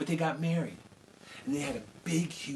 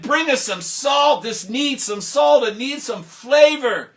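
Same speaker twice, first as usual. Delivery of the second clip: slow, loud, high demand; loud drawn-out protest; loud complaint; 11 semitones higher, 14 dB louder, slower than usual. Acted. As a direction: crowd growing impatient. Guests complain loudly.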